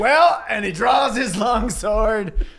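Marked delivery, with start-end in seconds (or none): 0.0-0.4 high, loud call; heroic Nightwing. Acted. Nightwing rallying the team with a loud high call. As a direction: ring it out like a battle cry. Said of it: "And then Nightwing goes".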